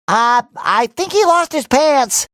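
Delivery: normal talking voice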